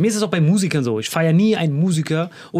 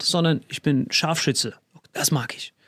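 ordinary talk

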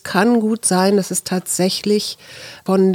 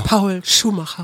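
mock theatrical. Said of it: "Das hört sich ja total theatralisch an".

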